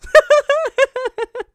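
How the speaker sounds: loud burst of laughter; big laugh. Real, not acted. Laughing at own joke.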